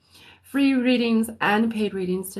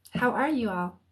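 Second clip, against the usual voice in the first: ordinary talk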